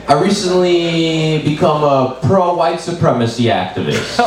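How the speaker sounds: normal talking voice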